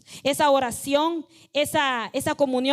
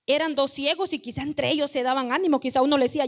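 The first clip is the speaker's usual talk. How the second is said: usual speaking voice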